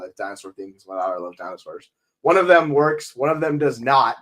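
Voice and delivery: ordinary talk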